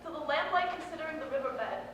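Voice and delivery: a poem's roll call, rising in force; bones' urging. Acted. Bones answering the trauma, urging the poem on with building force. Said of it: "My bones said".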